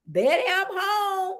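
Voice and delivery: playful sing-out on arriving home; hammed-up sitcom husband's greeting; mock homecoming call. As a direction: call out jokingly through the front door.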